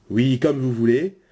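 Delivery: ordinary talk, nothing marked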